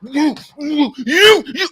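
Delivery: high, sneering mock jabs of "you"; jeering playground mimicry; mocking the critics. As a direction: parrot them pointing the finger back. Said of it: "they start to".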